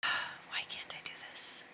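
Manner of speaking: very soft, whispered grumble to yourself; sigh, then puzzle under your breath. Real, not acted. Player stuck on a game command, whispering frustratedly.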